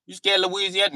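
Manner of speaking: high, loud teasing challenge; mock Louisiana woman. Louisiana woman teasing a nervous man, high loud taunt.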